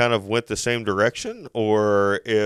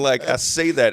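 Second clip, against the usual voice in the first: ordinary talk, nothing marked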